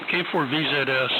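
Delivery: ordinary delivery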